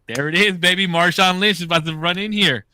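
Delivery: loud, high cheer for a sure win; excited cheering. Retold or imitated. Bettor cheering on the big screen, excited. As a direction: crow it like the bet is already won.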